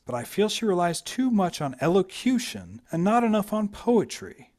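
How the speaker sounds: slight lift for the grandmother's wry judgement; a touch of a dry grandmother; lightly voiced old lady. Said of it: "Margaret Anstruther says".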